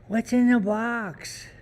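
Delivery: тянет фразу высоко и медленно; desperate movie quote. Pretend.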